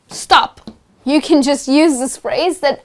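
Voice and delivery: ordinary talk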